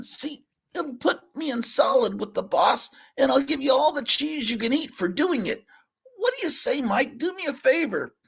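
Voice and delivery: high, coaxing cat voice selling a deal. Acted. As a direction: talk the mouse into it, high and sly.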